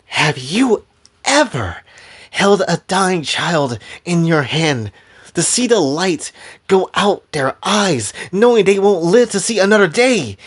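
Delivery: deliberate, pained challenge rising in pitch; impassioned anguish. Acted. Fighter recalls dying children to her opponents, building anguish. Anguished speech in acted confrontation.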